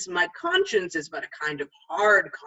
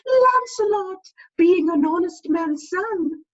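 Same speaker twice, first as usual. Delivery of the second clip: much higher, slow conscience voice; wise conscience; 10 semitones higher, 5 dB louder, slower than usual. Retold or imitated. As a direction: counsel him in a tiny piping voice. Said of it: "says very wisely to me".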